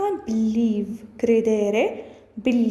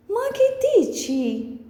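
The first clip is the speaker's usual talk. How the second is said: "Ma che dici" much higher, in disbelief; incredulous; 10 semitones higher than usual. Shown as a demonstration. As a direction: throw out "Ma che dici?" as if you can't believe it. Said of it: "Next one is".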